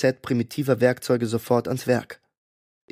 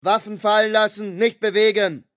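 higher, loud barked commands; bellowed through loudspeaker; 9 semitones higher, 3 dB louder than usual. A narrator's reading. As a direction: order them to drop weapons. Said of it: "Aus einem Lautsprecher wurden uns Anweisungen zugebrüllt".

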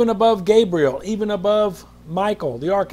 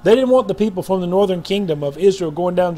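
louder, pressing on never; heated preaching; 2 semitones higher, 5 dB louder than usual. Real, not acted. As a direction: hammer the point. Preaching at full force.

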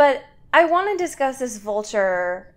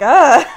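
a louder put-upon ugh at opening a door; overwhelmed groan; 4 semitones higher, 10 dB louder than usual. Retold or imitated. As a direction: groan like the world is too much. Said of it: "I'm just like".

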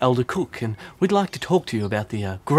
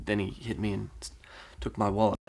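normal talking voice

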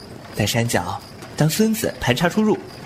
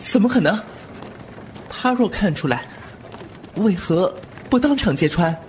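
惊讶追问; 8 semitones higher than usual. Acted. 惊问对方为何不揭穿.

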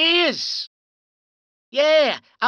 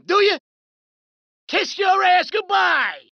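shouted threat; 5 semitones higher than usual. Acted. Thug in a gunfight.

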